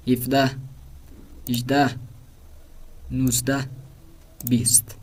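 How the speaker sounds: each number spaced out and said clearly for repeating; Persian counting drill. Shown as a demonstration. Teacher modelling Persian numbers for class to repeat, clear drill. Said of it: "تکرار کرتے ہیں مل کے".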